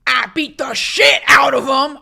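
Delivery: shouting. Real, not acted. Joking outburst about vapes.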